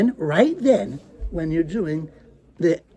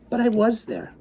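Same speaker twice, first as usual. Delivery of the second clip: ordinary delivery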